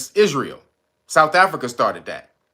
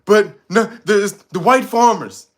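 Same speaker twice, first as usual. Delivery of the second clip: mock Trump; 5 semitones higher, 5 dB louder than usual. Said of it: "he said".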